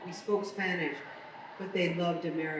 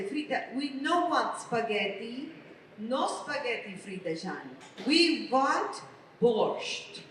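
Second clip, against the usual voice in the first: put-on higher, slow Russian Armenian accent; Russian Armenian babysitter; 3 semitones higher, slower than usual. Mother mimicking her babysitter about the girls' dinner, Russian Armenian accent. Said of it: "She was Russian Armenian".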